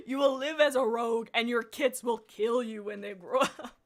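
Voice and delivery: loud, laughing through a harsh decree; stern ultimatum. Acted. Streamer voicing the leader banishing cats, loud with laughter. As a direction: growl the threat, cracking up at the end.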